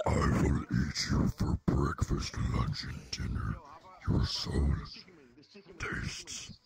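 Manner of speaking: a lightly voiced villain threat; menacing, a touch of unhinged villain; evil voice. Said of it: "wanna hear my evil voice".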